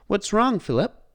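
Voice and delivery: high, loud alarmed question; anxious about her father; worried. A narrator's reading. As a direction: Belle worried at the horse. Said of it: "asked Belle".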